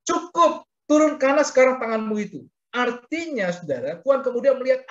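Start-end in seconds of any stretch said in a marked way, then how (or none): 0.0-2.4 raised, high-pitched quoted command; God's command. Retold or imitated. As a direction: call a halt in a lifted voice. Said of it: "Makanya dikatakan begini".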